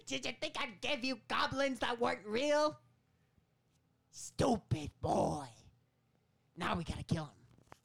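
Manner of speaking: high, loud sneering goblin voice; goblin taunt. Retold or imitated. Goblin-voiced Dafoe taunting the boy.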